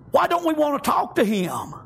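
ordinary talk

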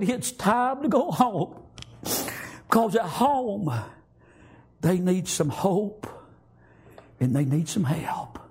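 slow and high, each word lifted; exalted repetition. Acted. Preacher repeating Jesus's call home, slow and high. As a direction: proclaim it slowly, rising in fervor.

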